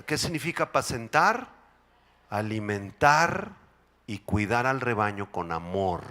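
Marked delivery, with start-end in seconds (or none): none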